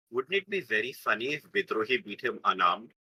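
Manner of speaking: thinking aloud. Acted. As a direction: muse it to yourself, quietly. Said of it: "I'm like".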